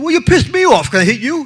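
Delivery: high, heated retort thrown right back; angry confrontation. Retold or imitated. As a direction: fire his own excuse back at him.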